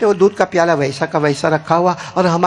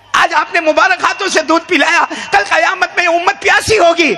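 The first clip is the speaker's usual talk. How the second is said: fervent plea; 7 semitones higher than usual. Acted. Abu Hurairah begs the Prophet to give water on Judgement Day, fervently. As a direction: plead with rising fervour.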